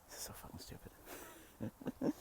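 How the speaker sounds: hushed aside under breath; whisper. Real, not acted. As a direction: whisper it to yourself. Aside breaking nature-show act.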